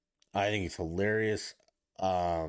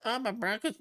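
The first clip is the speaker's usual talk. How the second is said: mock fan voice; 13 semitones higher than usual. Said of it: "Be quiet".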